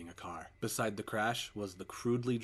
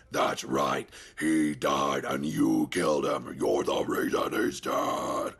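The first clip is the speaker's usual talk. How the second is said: much lower, slow, loud distorted voice; a monster growling the blame; demonic accusation; 6 semitones lower, 10 dB louder, much slower than usual. Acted. Entity blaming the father, low and loud.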